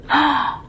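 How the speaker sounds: sharp high gasp of delight. Acted. Jenna gasping as her birthday cake arrives. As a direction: catch your breath at the cake.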